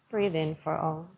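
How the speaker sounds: calm yoga teacher easing the class into stillness; guided meditation. Acted. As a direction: lead them gently through the breath. Guides a seated breathing practice.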